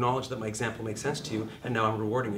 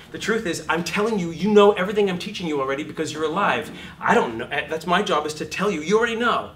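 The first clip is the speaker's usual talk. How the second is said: emphatic; 5 semitones higher, 8 dB louder than usual. Real, not acted. Coach driving point home.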